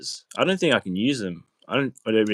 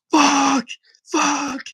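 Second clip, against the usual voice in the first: much higher, louder angry outburst; mock furious swearing; 5 dB louder than usual. Inmate raging behind the glass, high furious swears. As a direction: blow up like an inmate losing it. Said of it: "He's, like, just going crazy".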